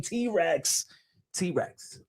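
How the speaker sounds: soft repeat. Real, not acted. Repeating the nickname.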